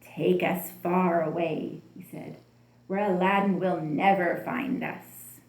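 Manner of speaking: a scheming sorcerer commanding his genie; wicked sorcerer. A narrator's reading. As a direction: gloat like a smiling villain. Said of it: "The sorcerer smiled".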